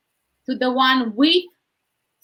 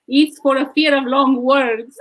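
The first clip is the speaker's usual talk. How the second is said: laughing; 3 semitones higher, 4 dB louder than usual. Real, not acted. Punchline of long-word joke.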